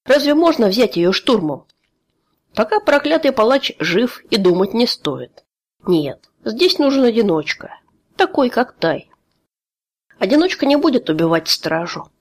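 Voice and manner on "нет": usual speaking voice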